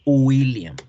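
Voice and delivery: William with a clean u-glide, no g; English W. Shown as a demonstration. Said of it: "Esa es la forma en cómo debo pronunciar".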